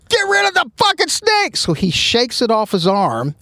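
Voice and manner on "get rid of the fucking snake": high, frantic mock command; panicked order. Chief ordering suspect to shake off snake, frantic high yell. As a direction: yell it like a cop who just saw a snake. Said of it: "the chief's like".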